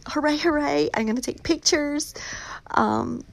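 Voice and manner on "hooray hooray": higher-pitched little cheer of hooray; celebrating. Real, not acted. Author celebrating her best-selling poetry book with listeners, celebrating. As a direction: lift the voice into a happy cheer. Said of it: "celebrate and say".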